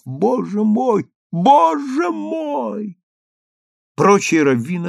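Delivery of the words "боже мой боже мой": high, drawn-out wail; horrified cry. A narrator's reading. Pale rabbi falls to his knees, horrified cry. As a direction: stretch each word in dismay. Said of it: "вскричав".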